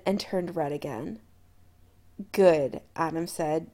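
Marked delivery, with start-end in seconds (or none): 2.3-2.8 low and dark with intent; a low promise, loaded with meaning; darkly. A narrator's reading.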